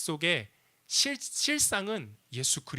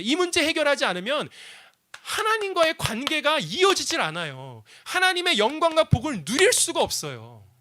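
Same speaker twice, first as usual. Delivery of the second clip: high, loud, driving sermon voice; fervent preaching; 8 semitones higher, 6 dB louder than usual. Acted. Pastor preaching to the congregation, at full force. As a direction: preach it out to the whole room.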